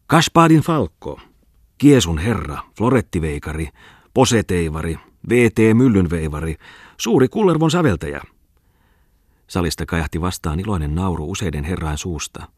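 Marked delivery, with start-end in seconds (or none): 0.1-8.2 grand introduction. Acted. Bungman presenting Lauri to the gentlemen, pompous and loud. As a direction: announce him like a grand herald, louder. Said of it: "heilautti mahtavasti kättään, kumarsi ja esitteli".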